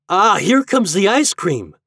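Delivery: put-on high, loud eager boy; excited exclamation. A narrator's reading. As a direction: exclaim at a treat arriving. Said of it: "exclaimed Herbie".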